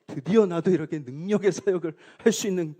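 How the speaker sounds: a touch of puffed-up triumph; proud boast. Pretend. Pastor imagining his own pride at healing power, building to a mock-proud cry. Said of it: "막 교만해지고 말이죠".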